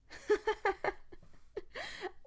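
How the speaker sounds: a high, breathy villain giggle; high creepy giggle. Acted. Villainess giggling at Milo's rage, high and creepy. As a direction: giggle with sinister delight.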